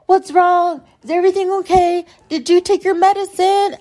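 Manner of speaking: high-pitched imitation of her; mock concern. Woman retelling her anxious check-in texts, high mock concern. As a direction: put on a high, fussing voice.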